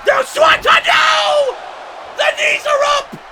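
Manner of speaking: shocked play-by-play scream; high panicked yell. Real, not acted. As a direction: cry out as the move fails. Commentator yells at failed Swanton.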